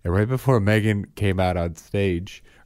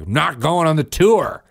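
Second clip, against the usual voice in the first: louder, higher grumpy refusal; sulky teen tantrum; 8 semitones higher, 6 dB louder than usual. Retold or imitated. Teen refusing the college tour to mom, loud and sulky. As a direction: stamp your foot like a sulking teen. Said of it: "really angry".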